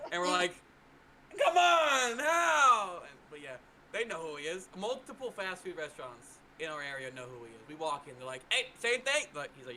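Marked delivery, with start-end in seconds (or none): 1.4-3.0 much higher, louder mock cry; mock wailing protest. Family protesting that the staff know him, in a much higher mock wail. 8.5-9.3 quoted staff. Retold or imitated. Staff greeting regular.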